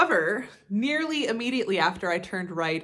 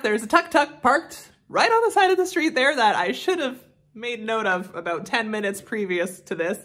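ordinary delivery

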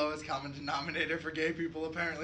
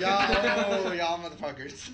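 laughing mock outrage; 4 semitones higher, 8 dB louder, slower than usual. Real, not acted.

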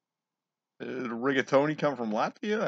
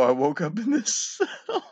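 laughing; 9 semitones higher, 4 dB louder than usual. Real, not acted. Laughing at punchline.

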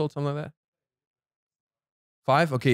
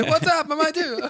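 high put-on Italian accent. Joking to friend in a high Italian accent. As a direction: throw on a big mock Italian accent. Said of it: "I'm gonna put on my Italian accent".

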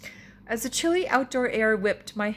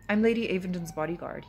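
ordinary delivery, nothing marked

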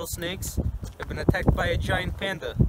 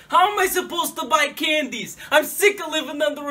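loud higher rant; ranting shout; 7 semitones higher, 6 dB louder than usual. Acted. Son ranting to dad about the robbery. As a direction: shout the complaint.

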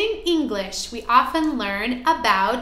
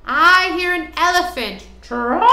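higher, louder and fast; trumpeting; 5 semitones higher, 6 dB louder, faster than usual. Acted. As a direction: blast it like an elephant. Elephant line read to kids.

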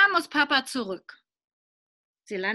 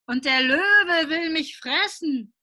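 higher, louder, slow pleading; panicky child; 7 semitones higher, 5 dB louder than usual. Acted. Boy pretending to be trapped, pleading loudly for the saw. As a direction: beg for the saw in mock panic. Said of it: "schreit Willi".

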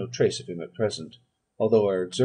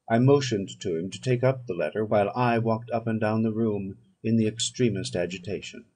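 ordinary delivery, nothing marked

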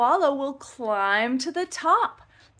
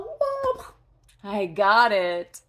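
usual speaking voice

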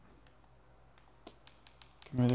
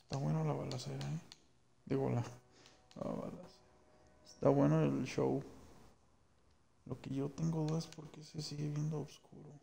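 quiet aside; 11 dB quieter than usual. Real, not acted. Streamer muttering aside.

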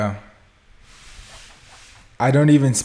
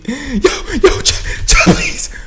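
panicked laughing; 4 dB louder, slower than usual. Real, not acted. Being chased in game.

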